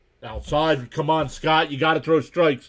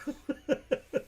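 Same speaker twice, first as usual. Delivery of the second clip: laugh; 12 semitones higher, 8 dB quieter than usual. Real, not acted. Laughs at the chat message.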